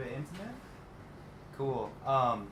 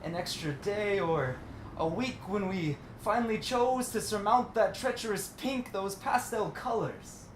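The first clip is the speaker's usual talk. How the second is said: higher, rolling spoken-word delivery; poetry recital; 7 semitones higher than usual. Acted. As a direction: recite it as verse, each phrase rising then falling. Resumes reading the poem.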